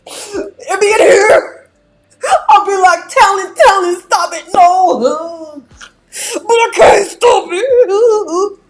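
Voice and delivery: high, loud, drawn-out fake sobbing; hammed-up wailing lament; mock despair. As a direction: plead melodramatically as if overwhelmed. Mock lament about unstoppable talent.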